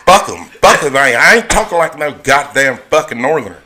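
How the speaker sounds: put-on defiant country Southern accent; Georgia cracker accent. Georgia good old boy scorning Northerners, thick country accent. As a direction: play a stubborn rural Georgian. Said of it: "talk like a cracker".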